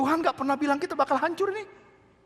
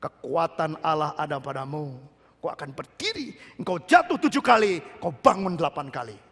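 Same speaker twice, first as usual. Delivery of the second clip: God's reassuring voice; 9 semitones lower than usual. Acted. Preacher voicing God's promise to the church, low and calm. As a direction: speak as God comforting his child. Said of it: "Tuhan bilang".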